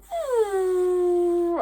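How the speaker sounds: high loud deflated phew; deflated exhale. Retold or imitated. Teen deflated by mom's reaction, a high loud phew. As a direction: let the air out. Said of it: "took all the wind out of my sails".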